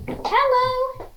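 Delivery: high squeaky puppet hello; goofy muffled sock puppet. Pretend. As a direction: greet like a silly sock puppet. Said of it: "Or I can go like".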